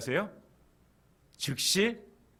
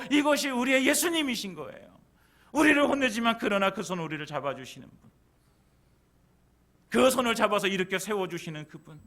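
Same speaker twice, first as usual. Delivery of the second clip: loud, high, full-force sermon delivery; impassioned preaching; 11 semitones higher, 8 dB louder than usual. Real, not acted. Preacher assuring congregation Jesus holds their hand, impassioned and loud. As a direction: drive it home to the congregation at full voice.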